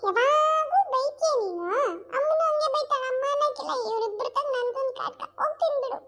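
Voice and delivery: put-on high whiny kid's voice, sulking; a small child complaining about being scolded; sulky child voice. Child whining to her drunk father about always being scolded.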